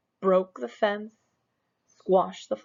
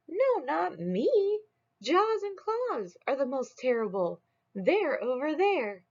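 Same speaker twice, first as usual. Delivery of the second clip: high little beast; 8 semitones higher than usual. Acted. A small beast answers Smug in a much higher voice. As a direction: squeak the denial, then point.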